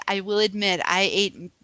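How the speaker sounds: usual speaking voice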